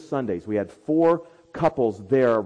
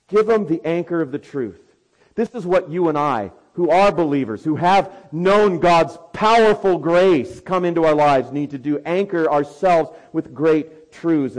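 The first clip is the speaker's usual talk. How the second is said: loud, higher, driving sermon voice; preaching at full force; 5 semitones higher, 9 dB louder than usual. Acted. To believers, anchor yourselves in truth, preached loudly. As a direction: lift the church with a ringing exhortation.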